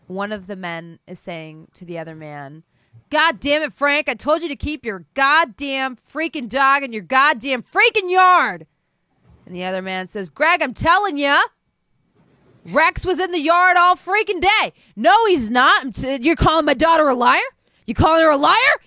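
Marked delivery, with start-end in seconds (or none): 3.1-8.7 loud, high furious yelling at the neighbor; angry neighbor yelling. Acted. Neighbor Greg yelling at Frank over the dog, furious. 10.3-14.7 loud, high protesting; defensive neighbor. Acted. Frank arguing back at Greg about his dog, loud and defensive. 15.0-18.8 loud, high, fast accusing; angry father. Acted. Greg accusing Frank of calling his daughter a liar, furious.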